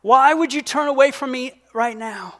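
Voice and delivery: high, loud anguished plea; crying out. Retold or imitated. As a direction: call out to a father who turned away. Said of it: "And you will cry out".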